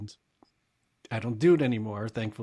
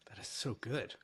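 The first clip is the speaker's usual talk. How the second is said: quiet; 8 dB quieter than usual. Real, not acted. Repeated praise, soft then loud.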